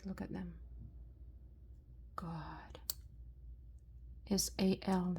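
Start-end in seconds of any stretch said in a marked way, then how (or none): 0.0-2.8 hushed whisper. Real, not acted. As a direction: breathe it softly, in awe. Showing earrings to camera.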